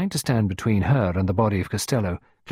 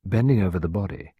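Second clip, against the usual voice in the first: ordinary delivery, nothing marked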